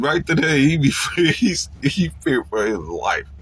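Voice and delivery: ordinary talk, nothing marked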